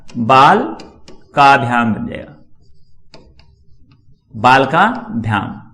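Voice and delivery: drawn out syllable by syllable; slow teacher dictation. Shown as a demonstration. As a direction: spell it out slowly for students copying. Teacher dictating while writing.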